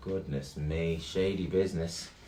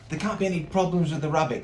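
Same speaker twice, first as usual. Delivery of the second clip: voiced character; 8 semitones higher, 3 dB louder, faster than usual. Storyteller voicing a character.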